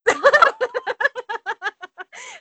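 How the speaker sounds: high loud burst of laughter. Real, not acted. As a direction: cackle out loud. Big laugh.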